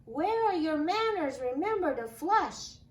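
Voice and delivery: put-on child yelling at a messy boys' room; embarrassed yell. A narrator's reading. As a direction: shout it out, flustered. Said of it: "I yelled with a blush".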